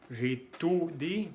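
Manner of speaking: J'ÉTUDIE slowly, with oo for u; Spanish u mistake. Shown as a demonstration. Teacher showing learners' mistake, back oo. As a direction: say J'ÉTUDIE as jay-too-dee. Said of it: "si pronuncian la U como en español U".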